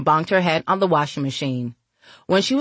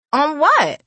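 put-on high, louder girlish question; impatient girl; 10 semitones higher, 5 dB louder than usual. A narrator's reading. Girl asks the woman her terms, high and impatient. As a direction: shoot back in a high voice.

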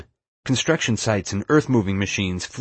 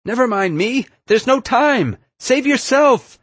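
very high shout, building louder; 6 dB louder, slower than usual. A narrator's reading. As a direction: shout across a construction site, urgently. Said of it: "Erica simply shouted through the construction site".

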